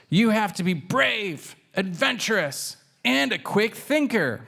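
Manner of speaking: high kitten voice listing; Pancake. A narrator's reading. Kitten lecturing her brother on bravery, high and proud. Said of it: "And she continues".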